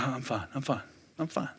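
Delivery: very soft, unconvincing reassurance; acting not fine, barely audible; weak denial. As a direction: trail it out small and quiet. Retold unconvincing answer while miserable.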